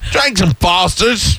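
high, very slow mock Australian; hammed-up Australian ad slogan; Australian accent. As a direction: stretch it like a cartoon Aussie. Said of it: "That's Australian".

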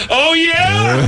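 high-pitched starstruck yelp; overexcited yell. Retold or imitated. Fan yelling in a rock star's face, overexcited yell. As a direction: yell it far too high, losing your cool. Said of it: "I yelled my reaction to him".

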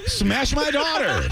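mock LA mom. Mom impression continued.

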